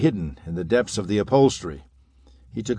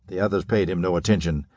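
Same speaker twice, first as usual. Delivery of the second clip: ordinary delivery, nothing marked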